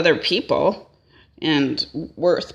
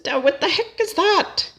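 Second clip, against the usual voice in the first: incredulous; 12 semitones higher than usual. Retold or imitated. Son's stunned question to dad.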